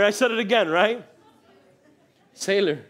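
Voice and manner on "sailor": ordinary talk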